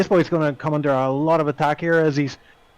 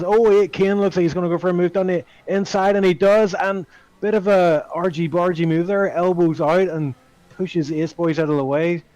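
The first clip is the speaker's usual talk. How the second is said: excited race call, higher and louder; motorsport commentator; 4 semitones higher, 4 dB louder than usual. Real, not acted. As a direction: call the overtake as it happens. Calling a live overtake move.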